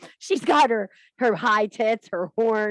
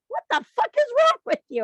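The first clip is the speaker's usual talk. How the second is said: husband recoiling; 14 semitones higher, 4 dB louder than usual. Retold or imitated. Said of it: "He's like".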